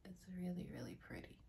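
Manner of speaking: softly whispered, no voice at all. Real, not acted. Unboxing vlogger admiring the item to viewers, whispered. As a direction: breathe the words out in a whisper.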